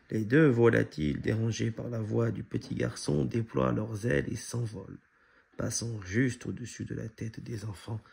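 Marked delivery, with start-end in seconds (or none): none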